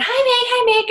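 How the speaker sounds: high, loud mock greeting; cheery greeters imitated. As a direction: call out hellos in a put-on high voice. Party guests greeting her, imitated.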